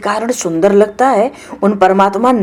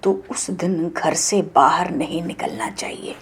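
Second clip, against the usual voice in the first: hushed advice; 10 dB quieter than usual. Real, not acted.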